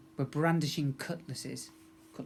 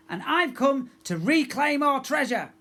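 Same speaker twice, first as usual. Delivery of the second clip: higher, louder proud yell; 11 semitones higher, 8 dB louder than usual. A narrator's reading. Pirate girl announcing herself to the hall, loud yell. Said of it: "she yelled".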